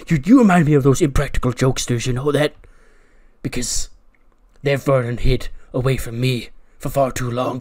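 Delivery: menacing wrestler promo voice; Karrion Kross. Acted. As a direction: threaten the kid like a tough guy. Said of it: "Karrion Kross goes".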